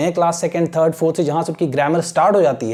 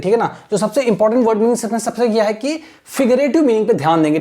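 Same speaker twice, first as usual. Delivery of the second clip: normal talking voice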